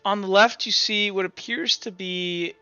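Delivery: ordinary delivery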